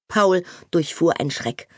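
ordinary delivery

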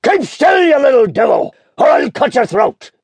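high, loud convict shout; fierce, menacing yell; terrible threatening voice. A narrator's reading. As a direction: threaten the child at full voice. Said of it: "cried a terrible voice".

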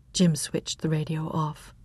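plain reading voice

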